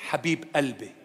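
low, soft Lebanese endearment; Lebanese accent. Shown as a demonstration. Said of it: "as they say in the Lebanese accent".

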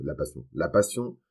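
usual speaking voice

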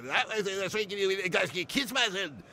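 a lightly put-on strong Glaswegian accent; mock Scottish accent quoting the sketch show. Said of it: "I know this Scottish accent, it's strong".

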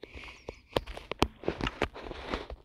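long, breathy fit of laughter. Real, not acted. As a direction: crack up helplessly, mostly air. Cracking up at sister's playing.

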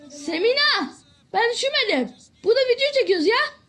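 high, loud annoyed shout; real irritated shout at a sibling who interrupts the video; angry yell at sister. Real, not acted. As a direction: yell at the sister to stop bothering you. Yelling at his sibling while recording.

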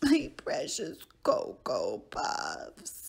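low dramatic sobbing wail; hammed-up grief over cereal; crying wail. Lost count, mourns cereal.

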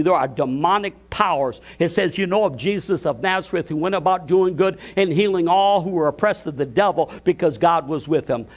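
normal talking voice

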